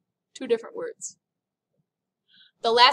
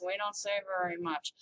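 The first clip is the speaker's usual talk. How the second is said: lower, quieter, lazy-mouthed talk; barely moving mouth; 5 semitones lower, 9 dB quieter than usual. Shown as a demonstration. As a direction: talk as if your lips hardly move. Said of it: "kinda like this".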